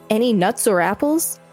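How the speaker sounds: ordinary talk, nothing marked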